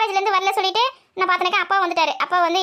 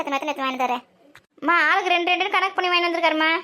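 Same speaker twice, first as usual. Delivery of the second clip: normal talking voice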